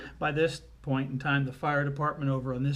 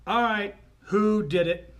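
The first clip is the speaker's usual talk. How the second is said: loud, raised-pitch demand; bossy little girl; 6 semitones higher, 7 dB louder than usual. Retold or imitated. Daughter confronts everybody at the railing, loud and bossy. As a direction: take charge like a tiny interrogator. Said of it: "and she went".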